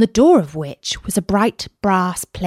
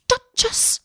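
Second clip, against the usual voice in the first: high anxious squeal, softer; panicked cry; 5 dB quieter than usual. A narrator's reading. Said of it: "heard it muttering to itself".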